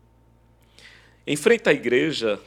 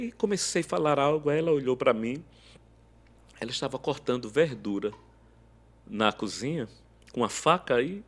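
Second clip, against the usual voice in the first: ordinary talk, nothing marked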